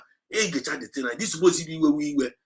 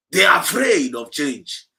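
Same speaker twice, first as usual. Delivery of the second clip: slow, loud shout of afraid; shouted declaration; 6 dB louder, slower than usual. Real, not acted. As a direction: hammer it out to the crowd. Preacher declaring to the audience.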